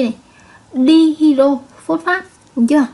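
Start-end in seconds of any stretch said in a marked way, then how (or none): 0.7-2.3 dihydrophosphate said slowly, stressing di; slow model reading. Shown as a demonstration. Teacher slowly modelling the new name for her students. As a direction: stretch out dihydrophosphate so the di is clear. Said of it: "Hai hydro thì các em sẽ đọc là dihydro".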